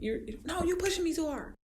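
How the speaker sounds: high whining protest; high-pitched whiny client. Retold or imitated. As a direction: complain like a worn-out client.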